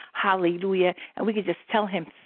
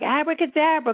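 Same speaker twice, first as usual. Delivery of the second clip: put-on a child chanting "Abracadabra" high and bright; kids saying abracadabra; 7 semitones higher than usual. Recalling childhood, a child's mock magic word. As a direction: play it like kids copying cartoons. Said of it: "we were like".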